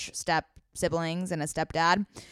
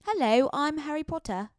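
higher-pitched put-on English accent; mock British accent, pitched up; Harry Potter; 6 semitones higher, 3 dB quieter than usual. Shown as a demonstration. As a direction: do a boy-wizard English accent. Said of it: "my other one is like".

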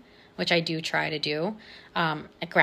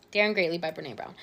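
Daring Greatly by Brené Brown said fast and softer; quick repeat; 2 semitones lower, 5 dB quieter, faster than usual. Shown as a demonstration. Podcaster repeating the book title to herself, fast and soft. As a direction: toss the title off again quickly, under the breath. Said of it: "I'm hoping that I'm saying her name correctly".

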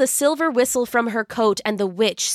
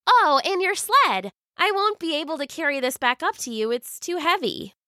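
put-on young girl voice; 7 semitones higher than usual. Silva girl voice.

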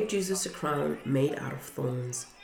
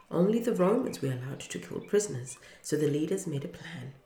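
ordinary delivery

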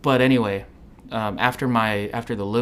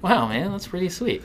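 mock amazement; 8 semitones higher than usual. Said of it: "And I was like".

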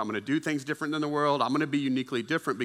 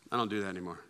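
reluctant refusal; 6 semitones lower, 8 dB quieter, faster than usual. Acted. Acted reluctant refusal of offer.

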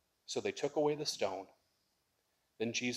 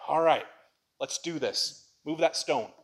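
resolute command; 3 semitones higher, 6 dB louder than usual. Acted. Jesus voiced resolutely.

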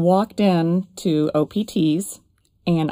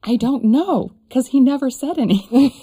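higher and louder, unsure; excited uncertainty; 6 semitones higher, 4 dB louder than usual. Retold or imitated. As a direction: blurt it out, unsure. Retold answer to friends.

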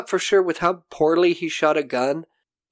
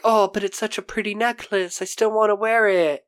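higher-pitched mock pleading; wheedling; 5 semitones higher than usual. Judy wanting the pretty necklace back, mock wheedling. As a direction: lightly imitate someone coveting a trinket.

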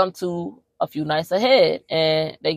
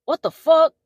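startled, much higher than usual; startled shout; 10 semitones higher than usual. Retold or imitated. Couple startled awake by crash.

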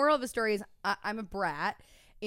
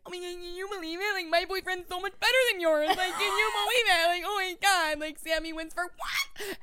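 high, fast tearful gush; high crying brag into voice memo; emotional gushing; 9 semitones higher than usual. Retold or imitated. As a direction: gloat while crying happy tears. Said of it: "like, emotional".

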